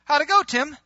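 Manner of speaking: high, loud call to account; expectant questioning. Acted. God asking Tim for his report, expectant questioning.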